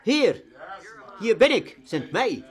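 Isaiah cries out, here am I; crying out. Acted. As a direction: call out as the prophet offering himself. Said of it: "riep hij uit".

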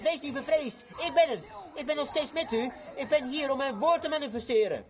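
preacher voicing Jesus telling the church not to fear; Jesus reassuring. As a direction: proclaim it high like the Lord calming them. Preacher voices Jesus comforting.

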